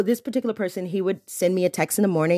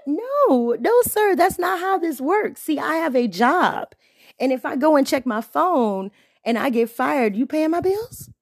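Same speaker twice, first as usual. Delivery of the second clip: higher, indignant telling-off; sassy scolding; 7 semitones higher than usual. Real, not acted. Telling off texter who demanded replies, high sassy scolding. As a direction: put him in his place, sassy.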